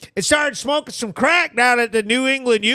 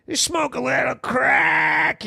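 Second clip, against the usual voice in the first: hammed-up sarcastic encouragement; exaggerated mock advice; 3 semitones higher than usual. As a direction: stretch CRACK out in a silly holler.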